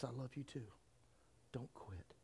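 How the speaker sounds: hushed, fading to a whisper; soft mother. Retold or imitated. Mother softly urging her son not to quit, whispered.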